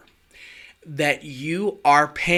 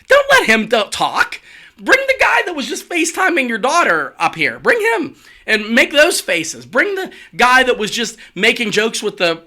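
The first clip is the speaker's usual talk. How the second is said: urgent pleading; 8 semitones higher than usual. Retold or imitated. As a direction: beg him to drop the stiff act. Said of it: "I'm like".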